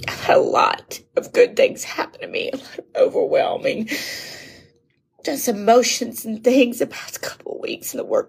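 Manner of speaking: tearful, voice slipping into a breathy near-whisper; fighting back happy tears as the words catch; overwhelmed with grateful tears, barely getting words out; crying. Real, not acted. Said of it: "Happy tears, grateful tears".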